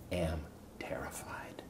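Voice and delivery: near-whispered admission of terror; hushed fear. Retold or imitated. Husband confessing his fear to the audience, whispered. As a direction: drop to a whisper, very soft.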